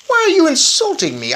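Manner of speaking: high, loud offended squawk; hurt and indignant; outraged. Acted. Bruno outraged at the narrator, high offended cry.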